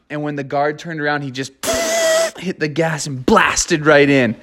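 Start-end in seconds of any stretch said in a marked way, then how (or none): 1.6-2.3 squeaky high giggle; a sudden high gleeful laugh; high cackle. Acted. Escape moment.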